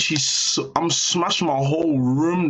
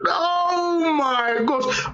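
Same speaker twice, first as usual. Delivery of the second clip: high, slow drawn-out gasp of shock; real, high-pitched, slow "oh my gosh"; stunned disbelief; much slower than usual. Real, not acted. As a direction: let the shock stretch the words out. Hearing the lizards died.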